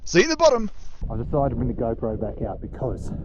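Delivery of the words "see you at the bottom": high bright goodbye; cheery sign-off. Real, not acted. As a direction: sing out the farewell.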